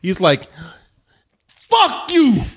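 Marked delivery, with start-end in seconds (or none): 1.5-2.4 mad yell. Retold or imitated. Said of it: "he got mad at some woman".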